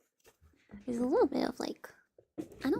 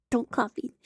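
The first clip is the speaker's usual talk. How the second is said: ordinary talk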